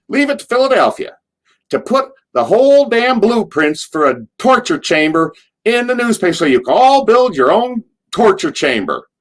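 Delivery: very high, loud Southern squeal; Cornette impression. Cornette rants about the torture chamber blueprint, shrill Southern squeal. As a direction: push the Southern whine to a shriek. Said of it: "as soon as I do his accent".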